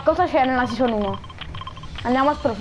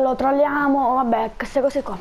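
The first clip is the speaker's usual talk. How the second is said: ordinary talk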